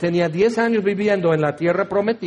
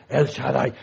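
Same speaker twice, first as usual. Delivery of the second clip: low, mock-mysterious intonation; 6 semitones lower than usual. Wondering what the impressive name means, low and mysterious. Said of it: "Como misterioso y que wow".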